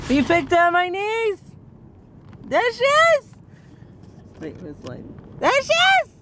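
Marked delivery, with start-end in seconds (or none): 0.1-3.3 high squealed introduction; excited announcing. Real, not acted. Aunt shows off the niece she picked up, excited squeal. 5.4-6.1 very high, gleeful cry; excited squeal. Real, not acted. Aunt finally gets niece in the light, excited squeal.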